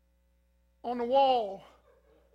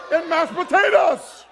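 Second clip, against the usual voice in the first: loud, slow, pitched-up cry of disgust; disgusted outburst; 5 semitones higher, 12 dB louder, slower than usual. Retold or imitated. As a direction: wail it out in horror. Disgust at crunching food.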